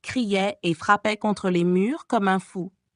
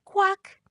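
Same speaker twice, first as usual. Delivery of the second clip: croak; 12 semitones higher than usual. Acted. Toad croak in Eric's song.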